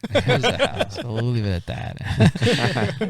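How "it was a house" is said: louder, higher insistence on "house"; insistent. Real, not acted. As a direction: insist firmly, voice jumping up.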